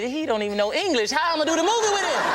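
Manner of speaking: incredulous complaint. Retold or imitated. Actor complaining to his agent about his co-star, incredulous. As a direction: pitch climbs high in disbelief.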